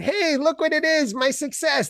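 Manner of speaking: high-pitched gleeful discovery; mock delight. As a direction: greet it like a happy surprise. Acts waking up to success.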